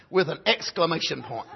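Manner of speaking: ordinary talk, nothing marked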